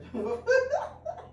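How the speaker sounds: high giggly burst of laughter; a real laugh at herself; goofy laugh. Real, not acted. Laughing at her own goofiness on camera, high giggle.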